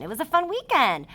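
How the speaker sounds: a teacher modelling an excited exclamation. Shown as a demonstration. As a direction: read it like the exclamation point is there. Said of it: "I'm showing excitement".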